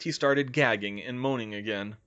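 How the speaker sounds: plain reading voice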